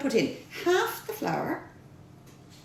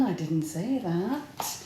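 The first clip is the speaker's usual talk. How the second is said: softer, slower conspiratorial aside; sneaking a denial past viewers about the Jameson, softer; cheeky aside; 6 semitones lower, 6 dB quieter, slower than usual. Real, not acted. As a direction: take it back with a wink in your voice.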